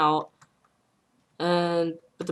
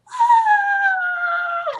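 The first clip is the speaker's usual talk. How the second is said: sudden shrill-pitched shriek, much higher and louder; high panicked scream; 7 dB louder than usual. Retold or imitated. Friend panicking on the freeway, high shriek. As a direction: let out a high panicked squeal. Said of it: "I just hear her screaming".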